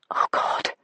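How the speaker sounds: whispered, fading breath; voiceless attempt. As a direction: try to speak, only air comes. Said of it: "I try to say again".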